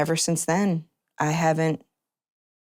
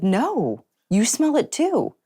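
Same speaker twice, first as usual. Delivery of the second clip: surprised; 9 semitones higher, slower than usual. Retold or imitated. Retelling her surprised reply.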